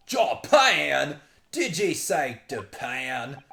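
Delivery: loud Scottish outburst, starting high; outraged Scottish accent. Acted. Governor hearing the visitor is from Japan, shocked Scottish accent. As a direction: burst out in disbelieving mock Scots. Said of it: "he reacts like this".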